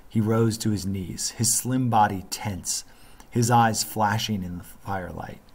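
ordinary delivery

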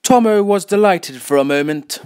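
usual speaking voice

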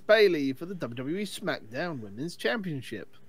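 loud, big ring-announcer billing; booming match introduction. Pretend. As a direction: announce the title match to the crowd.

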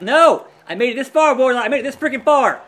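loud, frantic protest at the screen; panicked gamer yelling as he clings on; desperate shout. Real, not acted. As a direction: yell it like you're about to be thrown out. Fighting elimination, shouting at Wardlow.

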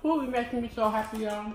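chewing while talking; talking through a bite of pickle; mouth full. Real, not acted. Seen 2: bit pickle at -1.0, cheeks bulge chewing until about 3.5.

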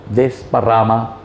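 ordinary delivery

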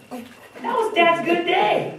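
high, slow, laughing line. Real, not acted. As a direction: savour the joke with a laugh. Laughing punchline about dad.

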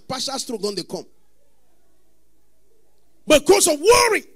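loud, high preaching climax; preaching at full force. Acted. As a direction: build the sermon into a shout. Preaching shout on worry.

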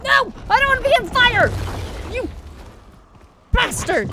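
high, loud, outraged yell; loud frantic protest; panicked shouting. Real, not acted. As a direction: shout in alarm and curse the attacker. Character set on fire in game.

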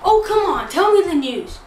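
ordinary talk, nothing marked

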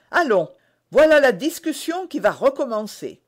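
put-on young woman half-smiling, impatient; half-smiling impatience. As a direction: tease him, a little impatient. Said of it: "avec un demi-sourire ... d'impatience".

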